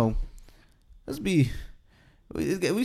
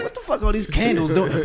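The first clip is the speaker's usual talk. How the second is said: annoyed wife voice; 5 semitones higher than usual. Retold or imitated. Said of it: "she's like".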